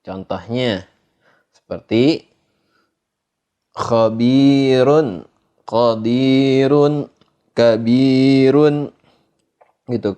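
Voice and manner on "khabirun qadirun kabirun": khabirun, qadirun, kabirun with long ee, light r; thin ro. Shown as a demonstration. Teacher giving examples, thin ro in khabirun, qadirun, kabirun. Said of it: "yakni tipis".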